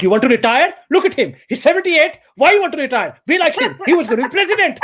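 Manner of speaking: loud, high-pitched comic rant; mock badgering. Comedian haranguing dad about retiring, high and loud mock badgering.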